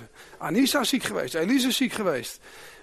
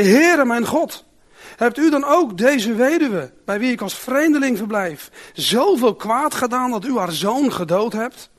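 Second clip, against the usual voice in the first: crying out to God; 4 semitones higher, 6 dB louder than usual. Acted. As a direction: call up to heaven in distress. Elijah cries out to God.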